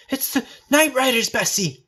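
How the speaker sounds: high, rushed warning cry; gasped warning. A narrator's reading. Child gasps a warning to Bessie, high and rushed. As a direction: gasp out the alarm. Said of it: "I managed to gasp".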